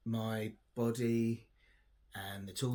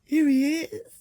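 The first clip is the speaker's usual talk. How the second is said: very high, loud sing-song; cooing delight at the dog; doggy voice; 13 dB louder, slower than usual. Real, not acted. Greeting her dog Pip, very high cooing voice.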